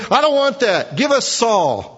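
high, loud bratty demand; mocking demand. Preacher mocks the people demanding Saul, high and loud. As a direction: sneer out the people's childish wish.

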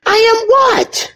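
high, slow questioning; mock puzzled. Preacher puzzling over God's name, high slow question. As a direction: ask it as if truly puzzled.

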